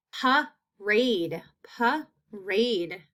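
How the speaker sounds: parade in two separate beats; split into syllables. Shown as a demonstration. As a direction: pause between pa and rade in parade. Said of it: "That's in that second syllable".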